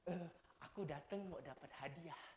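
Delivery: put-on very soft eager kid after a prize; hushed little kid who only wants the gift; greedy child. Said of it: "Ada juga yang datang".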